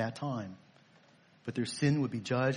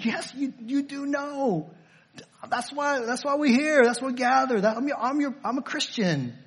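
eager mock believer; 10 semitones higher than usual. Mocking believer's eager reply.

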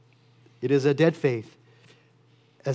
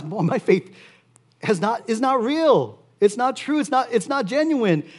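dismayed realization; 7 semitones higher than usual. Pretend. Imagined believer realizing dead faith.

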